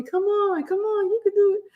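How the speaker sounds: high-pitched buddies cheering someone on; imitated encouragement. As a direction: urge a struggling friend on in a high voice.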